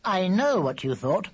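put-on high childlike voice; child's voice. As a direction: voice the kids in the story. Narrator voices children's dialogue.

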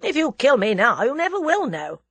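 defiant. A narrator's reading. Captive warns his captors, defiant. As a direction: stand up to the captors.

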